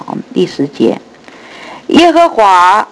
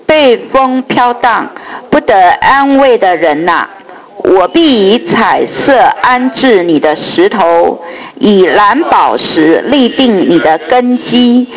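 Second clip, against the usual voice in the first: slow solemn group recitation; scripture reading; 3 semitones higher, slower than usual. Real, not acted. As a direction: read the verses slowly, with reverence. Congregation reads scripture aloud. (慢速庄重读经)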